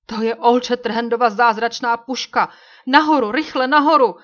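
high, loud panicked shout; alarmed shout. A narrator's reading. Warrior shouting alarm at the famous rifle, panicked. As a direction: shout in alarm to flee upward. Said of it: "Nějaký hlas zvolal".

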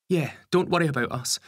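high-pitched, easy reassurance; mother's voice. A narrator's reading. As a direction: reassure your husband in a lifted, high voice. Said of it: "Lisa replies".

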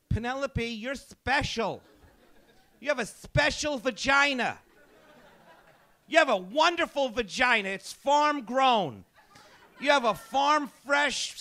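high, slow, syrupy parent voice; doting parent. Retold or imitated. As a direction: gush over the little princess. Parents coddling spoiled daughter.

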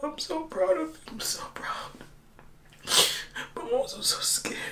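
fast, tearful whisper; crying whisper. Real, not acted. Telling viewers her fears before the race, crying whisper. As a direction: whisper it out between sobs. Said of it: "I don't know why I'm whispering".

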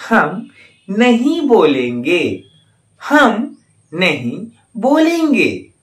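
slow, clear model sentence for learners; slow teacher's model reading; slow and careful. Shown as a demonstration. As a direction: spell it out slowly so they can repeat. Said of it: "आप उसे ध्यान से सुनिएगा और उसे अच्छी तरह से अभ्यास कीजिएगा".

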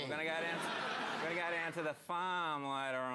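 broad Queensland farmer voice; queensland accent. Shown as a demonstration. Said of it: "do a Queensland accent".

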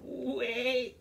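high, urgent little goat. Acted. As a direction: squeak it out to stop the troll. Goat pleads to troll.

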